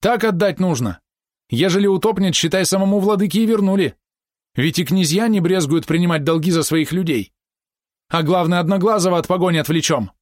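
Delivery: high, agitated pleading; anxious urging. A narrator's reading. Helmsman wants boy given to One-Eye, anxious urging. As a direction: argue urgently, keeping the pitch high.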